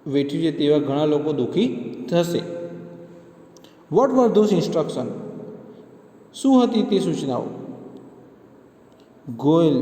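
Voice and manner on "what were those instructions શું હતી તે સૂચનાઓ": higher, louder pressing question; insistent questioning. A narrator's reading. Lawyer pressing the robot witness about the instructions, insistent. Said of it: "વકીલ ગોયલે આગ્રહપૂર્વક પૂછ્યું".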